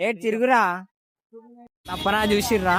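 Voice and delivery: high teasing shout; calling out. Real, not acted. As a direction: call out to him playfully. Calling out to friend.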